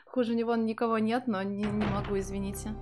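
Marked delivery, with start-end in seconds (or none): none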